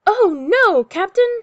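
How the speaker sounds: high, loud cry of alarm; storybook sailor's urgent shout; first mate's shout. A narrator's reading. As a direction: shout a warning to the captain. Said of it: "shouts First Mate Callie".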